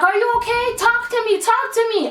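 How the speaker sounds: high, urgent worried mother; mother begging her choking daughter to answer; frantic mom. Retold or imitated. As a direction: plead like a scared mom. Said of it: "she was like".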